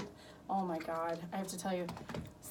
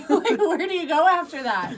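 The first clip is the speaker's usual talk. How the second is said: louder, higher, laughing; laughing quote; 8 semitones higher, 13 dB louder than usual. Retold or imitated. Mother asking daughter, laughing loudly. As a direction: laugh out the question. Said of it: "I'm like".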